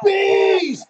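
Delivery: high, drawn-out loud yell; imitated yelling. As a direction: re-enact a friend's panicked shout. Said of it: "you started yelling".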